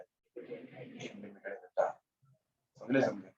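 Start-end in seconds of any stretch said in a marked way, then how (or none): 0.0-2.0 whispered hint. Shown as a demonstration. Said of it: "whispered answer hint".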